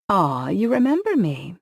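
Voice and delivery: sugar-coated taunt; sweetly. A narrator's reading. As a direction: fake sweetness toward an enemy. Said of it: "Abby said sweetly".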